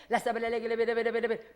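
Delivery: rolling syllables poured out in a steady stream; speaking in tongues. Shown as a demonstration. Preacher shows the church how tongues sound, fluent babble. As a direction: demonstrate tongues with the tongue running freely. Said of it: "내가 방언 말해야지".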